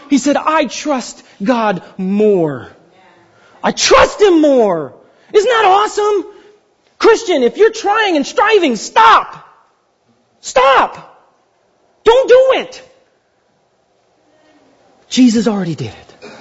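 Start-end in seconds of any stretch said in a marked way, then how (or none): 0.4-4.9 slow quote. Acted. Quoting Paul slowly. 8.8-12.8 stop, stop, said high and rising in urgency; high urgent plea. Acted. Preacher pleading with striving Christians, high and urgent.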